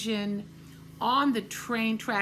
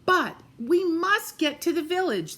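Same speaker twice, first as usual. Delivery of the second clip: urgent plea; 8 semitones higher, 5 dB louder than usual. A narrator's reading. Toys pleading urgently.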